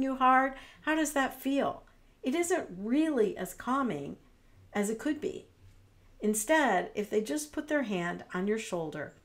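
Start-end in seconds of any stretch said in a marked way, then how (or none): none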